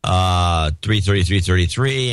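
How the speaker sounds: usual speaking voice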